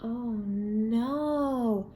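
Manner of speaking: plain reading voice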